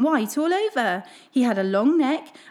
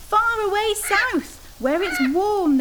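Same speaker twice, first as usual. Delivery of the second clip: much higher, slow calling swans; swans calling back; 9 semitones higher, slower than usual. Acted. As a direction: call down from high overhead. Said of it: "they called back".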